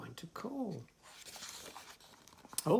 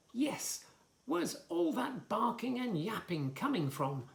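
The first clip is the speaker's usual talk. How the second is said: higher, puzzled frog; 7 semitones higher than usual. Acted. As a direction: voice the frog, high and curious. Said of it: "said the frog".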